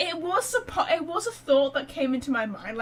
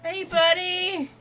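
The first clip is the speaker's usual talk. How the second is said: mock stern call-out; 4 semitones higher, 4 dB louder than usual. Calling out to game character.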